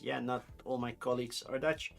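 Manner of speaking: usual speaking voice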